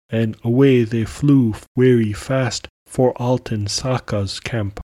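ordinary delivery

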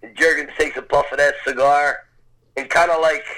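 ordinary talk

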